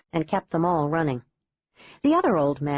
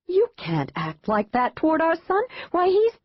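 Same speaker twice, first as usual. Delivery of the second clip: higher mother's voice, rising in outrage; a shocked mother defending her son; indignant mother; 11 semitones higher than usual. A narrator's reading. Mother snapping at the shop woman, outraged and higher. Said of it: "The mother gasped and stared".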